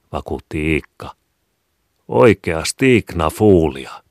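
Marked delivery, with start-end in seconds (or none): 2.1-4.0 Oikea Stignafuulia, louder and slow, insisting she should understand; the boy explains again to his mother, insistent; explaining, puzzled. A narrator's reading. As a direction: spell it out, amazed she doesn't get it. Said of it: "Selittävämmin, mutta samalla kuin ihmetellen".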